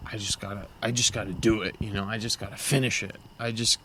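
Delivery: low, hushed self-talk; lightly voiced dogged muttering; imitated driven rider. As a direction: mimic someone grimly pushing themselves on, quietly. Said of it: "some people go out and they're like".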